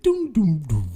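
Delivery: mock computer error tones, "doom doom doom"; imitated computer chime. Comic imitating his brain shutting down, computer-sound doom notes. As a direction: voice descending system sounds with the mouth. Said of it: "the window sound when it came off".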